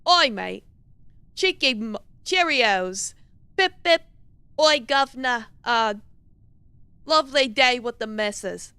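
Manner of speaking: put-on British chap warm-up; masculine British accent. Shown as a demonstration. Streamer trying to get into character, masculine British accent. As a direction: practise stock British phrases with gusto. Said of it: "masculine British accent".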